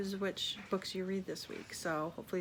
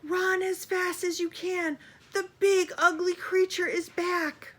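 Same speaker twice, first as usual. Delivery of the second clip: high, loud, panicked squirrel yell; shrill-free high squirrel cry, much higher and louder; squirrel shouting; 11 semitones higher, 9 dB louder than usual. Acted. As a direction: scream a warning to the whole swamp. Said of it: "let out a yelp and shouted".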